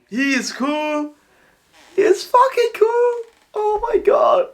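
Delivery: high, loud fangirl squeal; real fan squeal at a cool character; excited squeal. Real, not acted. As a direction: shriek it out in pure delight.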